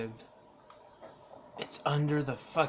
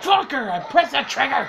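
furious yell, much higher and louder; angry shout; 10 semitones higher, 12 dB louder than usual. Real, not acted. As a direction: yell in frustration at the game. Alien attack, frustrated yell.

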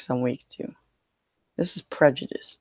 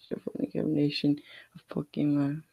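whisper; 6 dB quieter than usual. Real, not acted. Whispered aside while shuffling.

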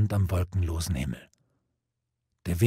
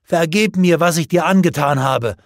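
higher, louder desperate cry; anguished plea; 6 semitones higher, 6 dB louder than usual. A narrator's reading. Lancelot begging dying Gawain for forgiveness, crying out. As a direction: beg forgiveness with everything you have. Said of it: "schrie Lancelot auf".

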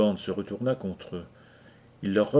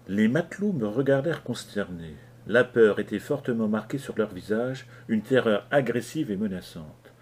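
ordinary delivery, nothing marked

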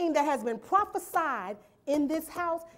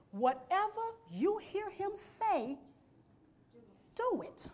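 quieter, slower echo of the line; "Whatever you hear him say" said softly and slowly; soft repeat; 4 semitones higher, 7 dB quieter than usual. Acted.

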